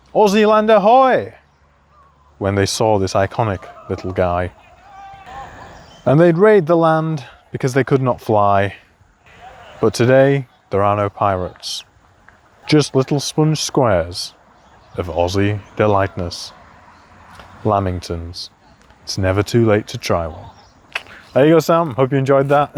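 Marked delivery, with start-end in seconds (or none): 0.1-1.3 high, drawn-out mock pirate call; pirate shout. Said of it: "the pirates would shout". 2.4-20.5 slow recital, falling low at line ends; poem recital. Shown as a demonstration. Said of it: "deliver this poem".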